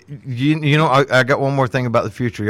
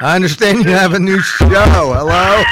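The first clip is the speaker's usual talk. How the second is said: loud raised hello, calling across; 6 semitones higher, 5 dB louder than usual. Real, not acted. Host calls out to the doctor on the line, loud. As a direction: call out to get his attention.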